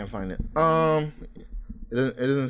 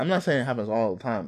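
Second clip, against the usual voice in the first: ordinary talk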